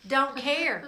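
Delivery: loud, high, punchy preaching; preaching at full force. Acted. Preacher brushing off where they worship, loud and high. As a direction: hammer it home to the crowd.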